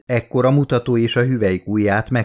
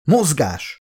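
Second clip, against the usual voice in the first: sharp, raised command; barked order; 5 semitones higher than usual. A narrator's reading. Charlie ordering the team to follow, raised command. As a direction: snap the team into motion. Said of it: "ordított fel Charlie".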